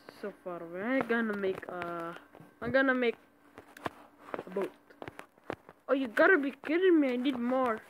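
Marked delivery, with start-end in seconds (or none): 5.9-7.8 frustrated. Real, not acted. Frustrated at missing materials.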